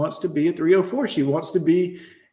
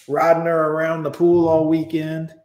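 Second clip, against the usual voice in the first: ordinary talk, nothing marked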